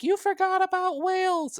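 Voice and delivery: very high, put-on indignant whine; mock complaining listeners. Guest quoting mad listeners who felt left out, mock complaint. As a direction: imitate an aggrieved listener in a squeaky high voice. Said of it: "they're like".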